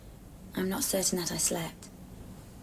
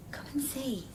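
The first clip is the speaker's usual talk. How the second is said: whisper; 4 semitones higher, 4 dB quieter than usual. Acted.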